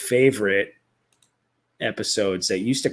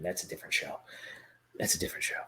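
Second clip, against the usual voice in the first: whisper; 6 semitones lower, 15 dB quieter, much faster than usual. Real, not acted. Correcting himself under his breath.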